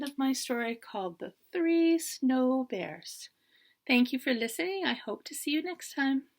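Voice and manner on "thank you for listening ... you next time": plain reading voice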